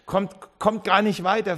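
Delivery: ordinary talk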